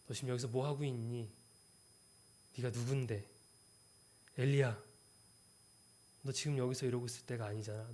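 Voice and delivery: soft, aching question from God; tender plea. Acted. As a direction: plead gently with a lost child. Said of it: "이렇게 애절하게 질문하고".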